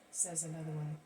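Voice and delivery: ordinary delivery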